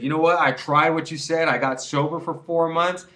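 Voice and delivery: hammed-up stoner relief; mock stoner. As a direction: overplay the relapse confession. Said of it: "You're not gonna be like".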